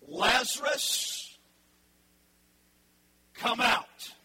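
slow, loud call to the dead, drawn out; loud drawn-out summons to a dead man; commanding call. Acted. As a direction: call him out of the tomb. Jesus calling Lazarus from tomb.

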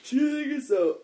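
words stretched by a yawn. Real, not acted. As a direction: talk through a yawn. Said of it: "Sorry, I'm yawning".

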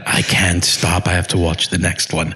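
Tony Hopkins. Acted. Hopkins unable to stop binge-watching, low mock impression. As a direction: play the grand actor helplessly hooked, low. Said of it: "I just picture him like".

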